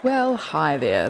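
ordinary talk

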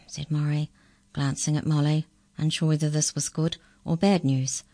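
ordinary delivery, nothing marked

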